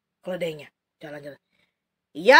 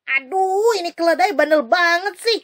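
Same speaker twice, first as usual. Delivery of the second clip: higher, louder irritated grumble; annoyed scolding; 6 semitones higher, 5 dB louder than usual. Acted. Bileam scolds his donkey, annoyed and louder.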